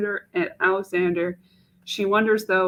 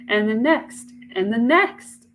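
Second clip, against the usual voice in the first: each next rising higher and louder; building repetition; 4 semitones higher, 5 dB louder, slower than usual. Acted. Mache dreads the years ahead, rising with each next.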